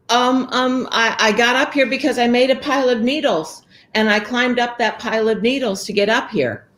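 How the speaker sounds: put-on high, nervous stammering girl; little girl fibbing to the ogre. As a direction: stammer out a quick lie.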